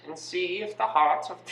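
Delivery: put-on high, slow, terrible German accent; evil scientist in drawn-out mock German; Doctor Mengele. Evil scientist announcing his experiment, high mock German accent. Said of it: "such a terrible German accent".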